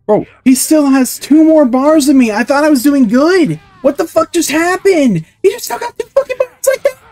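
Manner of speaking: very high screeching rant; real gamer meltdown at the top of the range; frantic rage. Real, not acted. Screaming after sudden in-game setback.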